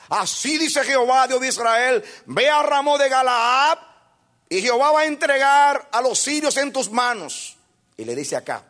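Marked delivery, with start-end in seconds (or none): 0.1-7.6 mock grand prophecy. Micaías mocking the king with a grand false prophecy. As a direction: ham up a fake oracle for show.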